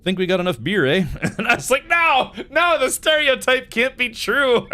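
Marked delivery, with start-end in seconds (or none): 0.0-1.1 put-on Canadian accent. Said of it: "he's like". 1.9-4.7 mock disbelief. Retells his own shocked disbelief.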